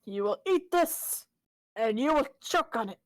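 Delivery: high, loud, forceful command; fierce in-character threat. Acted. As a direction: play it as a menacing force-feeder, voice pushed up. Acting out shoving a fish down enemy.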